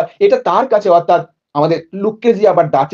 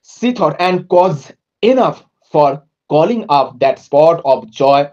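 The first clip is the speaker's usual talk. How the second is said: slow, drawn-out poetry recitation; poem reading; 4 semitones lower, much slower than usual. Acted. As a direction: read each word of the verse slowly.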